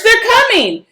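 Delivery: high, loud panicked warning; frightened high cry. A narrator's reading. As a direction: warn the others in fear. Attackers reach the rover.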